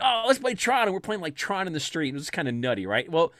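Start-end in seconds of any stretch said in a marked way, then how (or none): 0.0-0.8 much higher, eager call to play; excited suggestion. Retold or imitated. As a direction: pitch it up like an eager kid. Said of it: "people were like".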